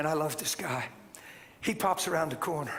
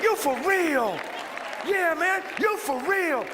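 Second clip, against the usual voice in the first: loud, high Southern exclamation; excited Southern holler, pitched up; imitated Southern amazement; 10 semitones higher, 5 dB louder, slower than usual. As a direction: mimic the amazed Southern fella, full voice. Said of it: "He said".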